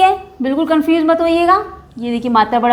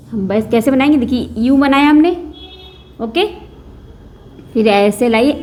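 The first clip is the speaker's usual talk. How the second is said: ordinary delivery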